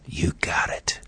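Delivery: menacing whisper; Satan's whisper. Retold or imitated. Satan answering the Son of God in a menacing whisper. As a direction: hiss it low like a threat. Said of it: "can't you almost hear Satan saying".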